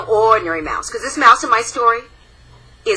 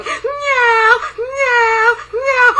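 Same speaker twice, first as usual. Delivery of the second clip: high, loud yowling meows; shrill, drawn-out cat cries; scary cat meows; 4 dB louder, much slower than usual. Acted. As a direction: yowl like a monster cat. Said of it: "kept coming towards them going".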